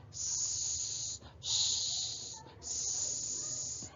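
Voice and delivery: long unvoiced hiss; snake hiss. Shown as a demonstration. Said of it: "A snake says".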